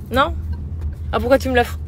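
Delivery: normal talking voice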